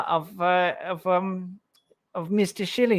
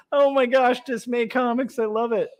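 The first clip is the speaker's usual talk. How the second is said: normal talking voice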